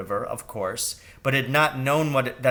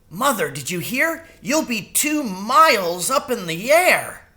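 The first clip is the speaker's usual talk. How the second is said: put-on higher, awed mouse boy; excited child; 9 semitones higher, 4 dB louder than usual. A narrator's reading. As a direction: gasp it out amazed. Martin thrilled to mother.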